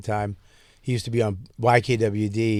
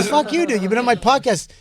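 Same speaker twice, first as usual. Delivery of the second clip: high-pitched mock outrage; retold indignation; 11 semitones higher, 4 dB louder than usual. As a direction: protest like a snubbed friend, voice jumping up. Retold indignant retort to friend.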